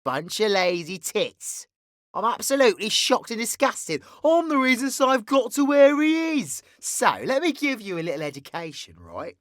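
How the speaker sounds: grandstanding villain scolding his darlings; flamboyant villain character. Acted. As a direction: stretch key words with mock-indignant flourish. V addresses the audience as villain.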